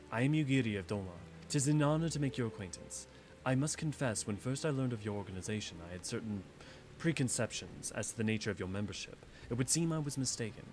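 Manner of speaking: understated formal warrior; formal Yugiri. Acted. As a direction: read her lines calm and courtly. Reads Yugiri's lines.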